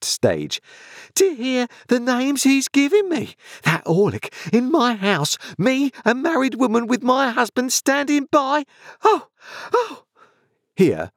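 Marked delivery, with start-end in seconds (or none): 1.1-8.6 very high outraged screeching; hysterical wife. Acted. Sister's hysterics continue. 9.0-10.0 short, very high shrieks; screamings. A narrator's reading. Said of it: "after a fit of clappings and screamings".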